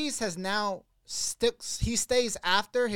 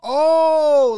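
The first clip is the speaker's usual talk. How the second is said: long, high, loud "oh" of realization; 9 semitones higher, 14 dB louder than usual. Real, not acted. Finally getting the gas money question, loud realization. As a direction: draw it out as it suddenly clicks.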